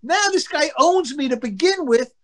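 high-pitched, pleading objection; exasperated protest. Retold or imitated. Embarrassed pitcher telling his teammate to stop, retold exasperated protest. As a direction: beg him to cut it out.

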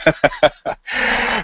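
breathy, unvoiced chuckle. Real, not acted. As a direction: laugh to yourself at your own anecdote. Laughs at own road anecdote.

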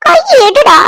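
high, sharp command; angry order. Acted. Woman threatens him to remove his hand, angry.

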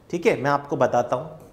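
ordinary talk

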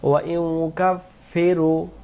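slow melodic tajweed chanting; slow chanted Quranic recitation. Shown as a demonstration. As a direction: draw the vowels out in a sung recitation. Recites verse demo.

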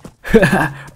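loud, high gleeful laugh; burst of laughter. Real, not acted. Laughing at the game.

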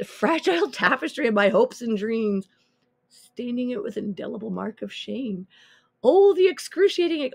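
slow, grand mock-tragic declamation; overly passionate reading. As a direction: ham up the despair like a bad stage actor. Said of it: "make everything more passionate".

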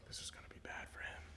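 hushed whisper; the boss watching the salesman, in a whisper. Retold or imitated. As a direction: breathe it out unvoiced. Boss's silent thought.

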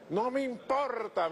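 high, drawn-out mimicry; high-pitched retold exclamation; imitated supporter's reply. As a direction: imitate an undaunted woman, stretched and high. Acts the woman's defiant reply.

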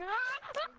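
sudden loud yelp of surprise; startled yelp. Real, not acted. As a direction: yelp out, caught off guard. Startled by icy floor.

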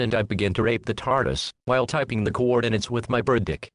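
ordinary talk, nothing marked

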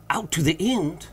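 mild, understated scream; screamed in excitement. A narrator's reading. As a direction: cry it out without a full scream. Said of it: "here fairly screamed Legrand".

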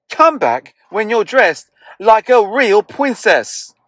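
high, haughty prince voice; snooty prince. Acted. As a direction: look down on her like a spoiled prince. Prince Ronald scolds Elizabeth.

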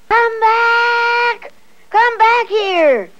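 loud high shout; calling out. A narrator's reading. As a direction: call them back loudly. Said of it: "said Peter".

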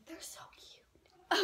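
slow whispered gush; whispering. Real, not acted.

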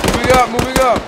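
loud urgent combat shout; shouting callout. Real, not acted. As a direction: yell it to the squad.

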